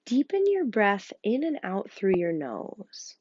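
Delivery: hushed breathing guide; guided meditation. Acted. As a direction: coax them to listen to their breath.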